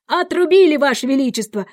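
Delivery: high, loud soldierly report; brisk chorus reply. A narrator's reading. Soldiers report to the Queen, loud and prompt. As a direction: report loudly, starting high. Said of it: "дружно ответили солдаты".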